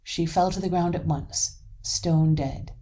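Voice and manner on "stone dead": plain reading voice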